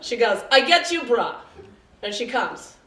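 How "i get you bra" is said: louder, higher Russian saleslady; bad Russian accent. Bra fitter offering help, bad Russian accent. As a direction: announce it in a makeshift Russian accent. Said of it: "I don't do a very good Russian accent".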